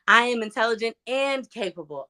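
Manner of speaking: "and" set apart and leaned on; stress on AND. Shown as a demonstration. Demonstrating the affirmation word by word, stress on "and". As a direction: hit the word "and". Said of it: "I would put emphasis on every single one of those words".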